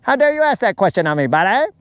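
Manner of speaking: high, fast, mock-aggressive Canadian tough guy. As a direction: get up in his face, eh, buddy. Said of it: "and he might be like".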